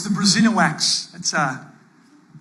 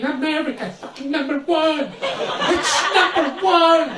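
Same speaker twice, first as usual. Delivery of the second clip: higher, hammed-up chant of a sports fan; mock fan scream; 10 semitones higher than usual. Comic imitating a basketball fan screaming at the game. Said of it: "he was screaming".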